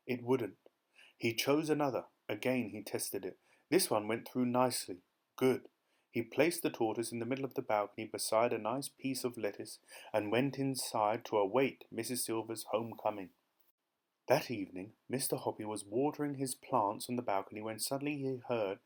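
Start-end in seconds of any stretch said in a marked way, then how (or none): none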